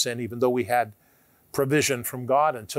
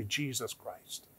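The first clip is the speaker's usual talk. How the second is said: soft, pleading prayer voice; hushed prayer; 4 dB quieter than usual. Shown as a demonstration. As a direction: lead the sinner's prayer gently. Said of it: "Just pray out loud and say".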